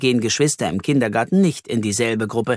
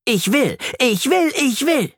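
put-on a furious child repeats himself, over and over; tantrum shouting; 12 semitones higher than usual. A narrator's reading. Flori yells at the teacher who won't let him out, mock tantrum. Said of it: "Flori schreit auch immer dasselbe".

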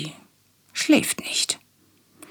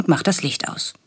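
plain reading voice

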